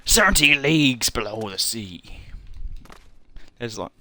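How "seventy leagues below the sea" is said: put-on pirate voice; pirate accent. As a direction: declaim it like a pirate. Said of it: "uh, pirate accent".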